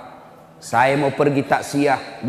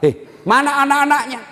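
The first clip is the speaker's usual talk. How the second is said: pitched up high in startled disbelief; a sudden, alarmed "where are the kids?"; surprised question; 10 semitones higher than usual. Retold or imitated. Preacher startled that the widow is alone, asking sharply and high.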